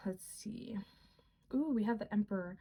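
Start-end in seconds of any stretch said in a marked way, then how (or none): 0.1-0.8 whisper. Real, not acted. Shuffling cards, talking to herself.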